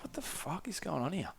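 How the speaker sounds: put-on confused traveller under his breath at the kids; puzzled aside. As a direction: drop it right down, baffled.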